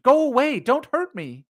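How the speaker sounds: high frightened begging; terrified screaming plea. A narrator's reading. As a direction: pitch high, pleading in fear. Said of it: "it screamed".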